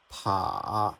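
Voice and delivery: "pa" dipping low, then lifting; third tone. Shown as a demonstration. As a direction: scoop "pa" down and up. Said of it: "the logic is all the same".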